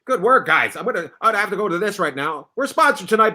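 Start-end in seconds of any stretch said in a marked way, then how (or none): none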